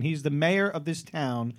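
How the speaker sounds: ordinary delivery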